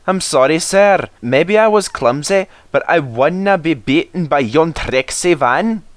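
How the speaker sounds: high Scottish engine explaining; sorry yet stubborn; apologetic Douglas. Acted.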